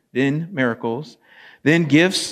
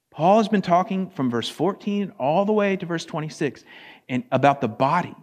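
ordinary delivery

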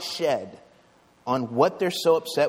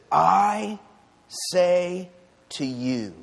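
loud, high "I", then a pause; slow, weighty words with "I" set apart; emphatic declaration; 4 semitones higher, 4 dB louder, much slower than usual. Acted. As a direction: punch "I", then slowly "say to you". Preacher voicing Jesus' direct address.

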